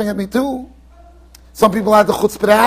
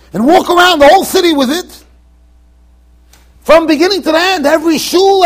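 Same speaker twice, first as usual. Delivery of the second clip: taunting dare; 7 semitones higher, 6 dB louder than usual. Retold or imitated. Card player dares Rav Pesach, loud taunting challenge. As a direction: lay down the dare loud and pleased.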